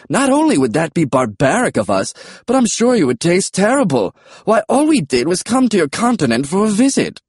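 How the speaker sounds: high, lilting British dinosaur; swooping high British character voice; British pterodactyl, protesting. A narrator's reading.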